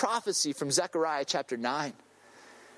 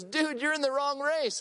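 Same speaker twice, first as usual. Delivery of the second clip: higher-pitched imitation of a chuckling runner; amused runner; 6 semitones higher than usual. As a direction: quote the amused stranger, pitched up. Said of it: "he chuckles and he laughs".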